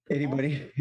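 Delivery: usual speaking voice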